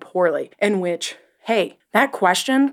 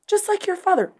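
much higher, prim put-on voice; mock Ahsoka; 12 semitones higher than usual. Host mocking Ahsoka's line in a high voice. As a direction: parrot her smugly. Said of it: "she's all like".

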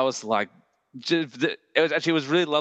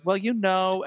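put-on Jersey accent; 7 semitones higher, slower than usual. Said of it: "she did this impression".

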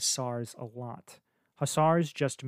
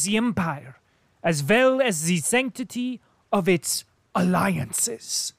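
high, loud German colonel; the stout German colonel insisting; loud, high-pitched indignant German officer; colonel's German accent; 7 semitones higher, 8 dB louder than usual. A narrator's reading. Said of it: "speaking with a German accent".

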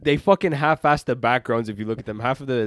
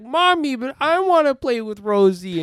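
put-on much higher, louder whining child; whiny kid; 11 semitones higher, 4 dB louder than usual. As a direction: whine it like a spoiled little kid begging mom. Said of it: "bitch and complain and cry".

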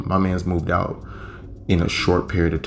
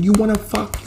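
high mock woman's yell; angry woman yelling; 13 semitones higher than usual. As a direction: throw the question at him in a high voice. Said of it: "She's like".

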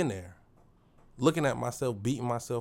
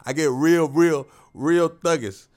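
cracking up mid-sentence; fighting back a laugh, slowed down; laughing at himself, louder; 8 dB louder, slower than usual. Real, not acted.

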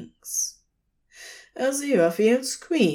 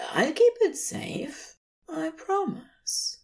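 Aziraphale's voice; 11 semitones higher, 3 dB quieter than usual. A narrator's reading. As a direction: offer your heart tenderly. Aziraphale's voice, tender vow.

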